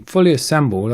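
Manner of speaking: usual speaking voice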